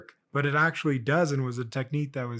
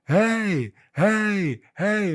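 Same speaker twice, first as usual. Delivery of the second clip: high, loud hey-hey-hey; imitating a man yelling hey across a lake; calling out; 8 semitones higher, 5 dB louder, much slower than usual.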